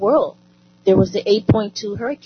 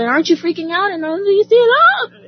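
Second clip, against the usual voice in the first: high, rising mock-panicked voice of anxious callers; lightly hammed-up alarm, much higher; imitating worried callers; 12 semitones higher, 3 dB louder, faster than usual. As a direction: squeak it out like a frantic caller. Mimicking worried callers on phone.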